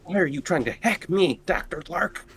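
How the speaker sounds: ordinary delivery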